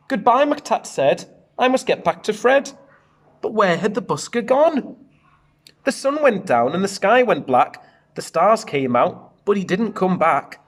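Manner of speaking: put-on lively read-aloud to children; bouncy storybook narration; story reading. A narrator's reading. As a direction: read it out like a picture book for the class. Teacher reads picture book aloud.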